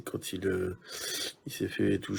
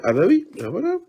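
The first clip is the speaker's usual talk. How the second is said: much higher and louder, delighted; excited; 10 semitones higher, 9 dB louder, faster than usual. Real, not acted. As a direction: exclaim the find. Finds another missed parallel. (ravi, beaucoup plus aigu et fort)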